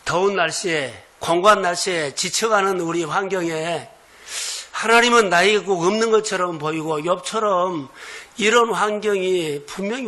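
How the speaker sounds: loud, rising, impassioned sermon climb; building pulpit fervor; fervent preaching. Acted. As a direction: preach it out with full force. Preaching builds to full force.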